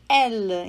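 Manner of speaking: elle with an open E, ɛl. Shown as a demonstration. Teacher to student: open E as in elle. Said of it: "se pronuncia assim também".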